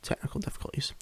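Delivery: hushed whisper to himself; whispering so his sleeping fiance stays asleep; whispered aside. Real, not acted. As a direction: breathe the words so as not to wake anyone.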